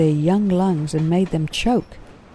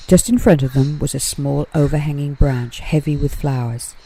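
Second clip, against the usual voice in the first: plain reading voice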